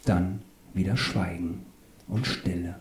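hushed, fading almost to a whisper; savouring the silence. A narrator's reading. Narrator relishing silence in the car, hushed and slowing.

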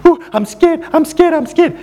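high, whimpering I'm scared; high mock whimper of a frightened rugby player; imitating whimpering fear. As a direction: mimic a big man whimpering in fright. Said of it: "started whimpering in fear".

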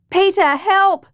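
high frantic shout for help. Acted. Wife screaming to her husband for help, frantic shout. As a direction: cry out for her husband in panic. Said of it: "Janelle shouted".

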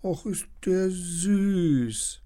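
put-on slow, high, cooing over a cute baby dino; lightly voiced girl. Lilly cooing slowly at the tiny baby dinosaur. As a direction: melt at the sight of it.

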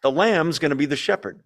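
ordinary delivery

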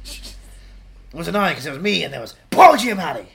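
usual speaking voice

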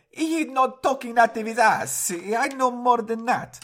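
high slow comic pope; next pope voice. Pretend. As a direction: play a pompous foreign pope. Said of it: "the next pope will go".

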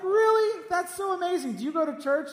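high-pitched, gushing retold amazement; mock delight. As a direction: act thrilled, pitch leaping up on the first word. Acted delighted reply to telemarketer.

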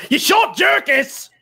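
high, drawn-out yell; theatrical high holler; shout. Acted. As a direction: shout the insult slowly, pitch way up. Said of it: "when Reggie shouts".

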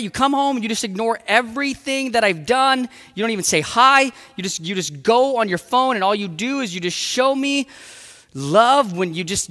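loud, high, heated complaint; wife scolding husband, loud acted anger; angry ranting wife. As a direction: pour out the grievances with heat. Wife's angry rant continues.

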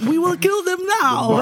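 put-on high, hammy Russian villain accent. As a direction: sneer it like a Bond baddie with a Russian accent. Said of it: "Russian villain, eh, eh, accent".

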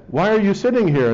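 officer voice. Retold or imitated. Mountie questions the stoned driver, mild official tone. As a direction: press her like a polite cop. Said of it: "And he said".

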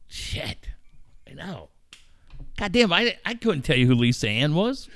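normal talking voice

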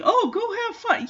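high sing-song mockery; mocking imitation. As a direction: parrot it back scornfully. Mocking the father's dismissive advice.

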